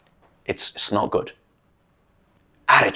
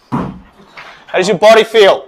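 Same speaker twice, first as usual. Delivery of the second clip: louder, slower, drawn-out question; hyped-up repeat; 3 semitones higher, 5 dB louder, slower than usual. Real, not acted. Coach asking again with more energy. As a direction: pump them up with the question.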